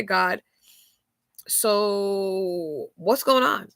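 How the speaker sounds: ordinary delivery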